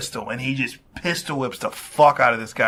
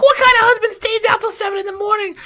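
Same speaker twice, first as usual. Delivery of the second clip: much higher, fast shrieking scold; nagging mother-in-law; 5 dB louder, faster than usual. Retold or imitated. Mother-in-law scolding the husband at dawn, high fast mock scold. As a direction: scold him like an outraged mother. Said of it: "He's like".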